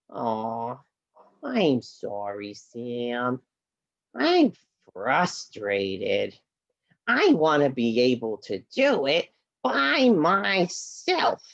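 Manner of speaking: a sad little horse puppet apologising; low and downcast, speaking to Sam; Henry the puppet. Acted.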